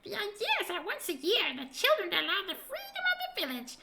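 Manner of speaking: high squeaky sprite voice; a merry little fairy explaining the fun; tiny creature. Acted.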